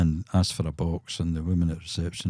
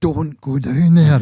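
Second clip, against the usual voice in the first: drawn-out high, loud mock cry; horror movie warning; 9 semitones higher, 11 dB louder, slower than usual. Watching a horror film's basement scene, mock warning shout. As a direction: shout it slowly like a horror audience. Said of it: "staircase that's in every horror movie that you go".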